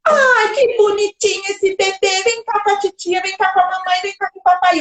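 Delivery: high, sing-song cooing; exaggerated doting adult babytalk; gushing baby talk. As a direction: coax a baby over with sugary excitement. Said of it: "chega o adulto com aquele carão na frente".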